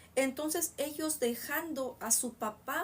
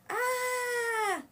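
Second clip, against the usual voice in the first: long, high-pitched acted scream; grito del endemoniado; 11 semitones higher, 4 dB louder than usual. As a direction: scream like the possessed man. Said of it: "el cual dio voces". (grito agudo y sostenido, actuado)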